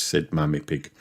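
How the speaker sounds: ordinary delivery, nothing marked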